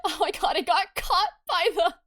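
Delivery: high, loud laughing yell; laughing shriek. Real, not acted. Laughing shriek at auto mod.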